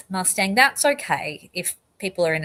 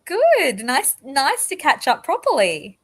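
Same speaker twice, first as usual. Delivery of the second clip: cheerful reply; 6 semitones higher, 4 dB louder, slower than usual. Real, not acted. Greeting a guest joining stream.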